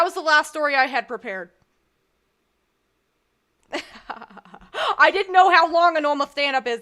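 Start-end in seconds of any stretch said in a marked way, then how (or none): 5.0-6.8 embarrassed laugh, louder. Real, not acted. Laughing embarrassed after set.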